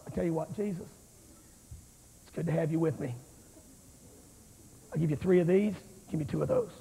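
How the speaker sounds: a lightly voiced card dealer making a deal. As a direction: bargain with God like a card dealer. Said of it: "a card dealer".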